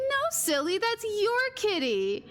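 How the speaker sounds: high, slow playful sing-song; teasing sister. Retold or imitated. Sister revealing the kitten, high playful sing-song. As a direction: gently tease her little sibling.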